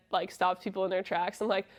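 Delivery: ordinary delivery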